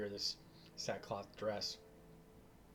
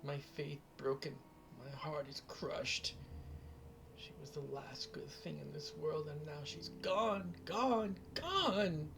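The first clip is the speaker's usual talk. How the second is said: put-on high, wailing old crone, rising to cries; sobbing old woman; 6 semitones higher than usual. Old mother warning the heroes of Strahd, high sobbing wail. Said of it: "She just sobs".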